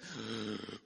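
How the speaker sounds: sharp, quiet gasp of panic; a soft startled intake of breath; panicked gasp. Retold or imitated. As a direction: gasp like the floor just dropped away. Panicking in the corner before speaking.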